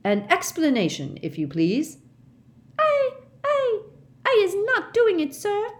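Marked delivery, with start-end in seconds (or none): 2.8-5.7 very high, squeaky frightened elf voice; a terrified little elf gasps out her denial; gasping house-elf. A narrator's reading. Frightened house-elf to Mister Diggory, high squeaky gasp. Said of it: "Winky gasped".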